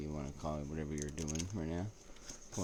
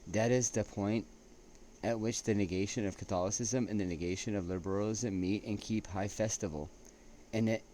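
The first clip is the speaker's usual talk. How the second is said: reading a quote aloud; 3 semitones higher, 6 dB louder than usual. Acted. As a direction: read it out like a solemn citation. Reading a quote by Lord Acton.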